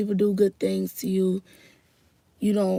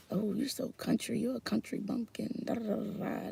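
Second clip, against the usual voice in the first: soft, dismissive mimicry; mocking teasers; 10 dB quieter than usual. People teasing her for being country, muted mockery. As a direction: imitate teasers under your breath, much quieter. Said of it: "not laugh at me".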